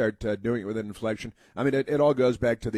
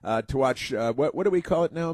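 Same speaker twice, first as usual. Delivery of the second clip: ordinary talk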